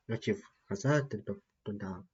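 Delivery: ordinary talk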